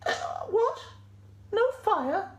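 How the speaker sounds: very high sheep voice, taken aback; baffled sheep. Acted. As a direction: question it in a tiny high squeak. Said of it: "said Sally".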